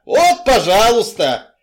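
high, loud, drawn-out retort; exasperated slow yell. A narrator's reading. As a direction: stretch the words out in a shout. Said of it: "Мы орали всё громче".

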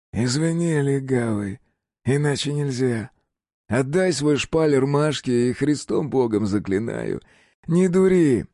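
calm threat ending in a bark; menacing gangster. A narrator's reading.

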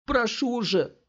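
very high, cracking plea; voice breaking to a squeal. A narrator's reading. Scott begs the thugs, voice breaking. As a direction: let the voice crack upward. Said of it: "голос его сорвался на визг".

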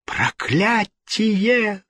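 very high, drawn-out curse; furious cry. A narrator's reading. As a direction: curse in shock, stretching the word high. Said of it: "вскричала Екатерина".

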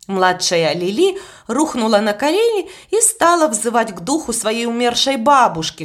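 plain reading voice

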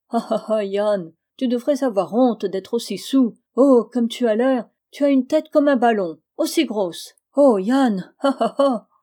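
jeering, loud and swooping, with spoken ha-ha-ha; loud mockery. A narrator's reading. Narrator ridicules his drunken friend Yann, loud jeering.

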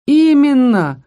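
loud, high confirming shout; emphatic. A narrator's reading. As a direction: shout it together in agreement. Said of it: "хором выдали парни".

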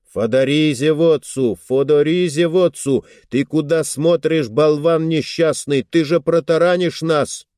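loud, furious yell at a fool; angry yelling. Acted. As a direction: bellow at the reckless captain. Yelling at ramming ship's captain. (гневный крик)

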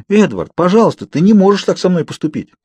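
high, agitated plea; urgent pleading. A narrator's reading. Girl begging Edward not to do this, pleading.

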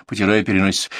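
plain reading voice

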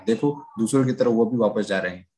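ordinary delivery, nothing marked

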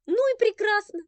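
high, loud huffy cry; abandoned girl huffing to herself, high and loud; defiant outburst. Acted. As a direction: fling it out in hurt defiance. Offended girl's inner outburst.

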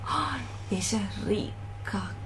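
a secret thought said under the breath; hushed aside. Acted. Mother muses aside on the girl's wealth, hushed. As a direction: drop almost to a whisper, half to herself.

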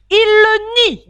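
very high, loud royal cry; shouted proclamation. A narrator's reading. The king echoes the hare's denial, shouting. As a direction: proclaim it at full voice.

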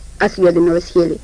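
usual speaking voice

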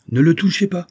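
high, loud protective cry; urgent shout. Acted. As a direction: stop your comrades dead with it. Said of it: "cria le colonel à ses camarades".

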